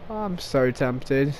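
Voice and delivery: low, slow, hushed to himself; quiet tempted murmur. Real, not acted. Gamer to his viewers about shooting teammates, a low slow murmur. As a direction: keep it down, savouring the temptation.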